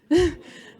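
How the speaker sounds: a sudden, high, loud laugh; loud burst of laughter. Real, not acted.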